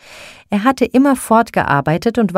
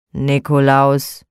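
slow, low grumbled name; surly stranger grumbling his name; 4 semitones lower, slower than usual. A narrator's reading. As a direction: drag the name out sullenly. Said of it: "brummte er ebenso mürrisch als zuvor".

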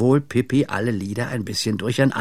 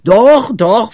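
earnest; 11 semitones higher, 11 dB louder than usual. A narrator's reading. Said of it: "versicherte Pippi ernst".

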